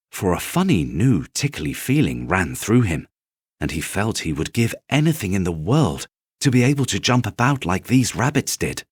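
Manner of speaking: plain reading voice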